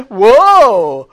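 high, very loud whoa; acted wonder. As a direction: play amazement at the top of your voice. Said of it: "'cause we all wonder".

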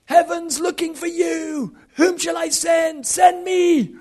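high, stretched-out pulpit call; imitated rousing preacher. Preachers calling for missionary workers, high impassioned exhortation. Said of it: "all those messages".

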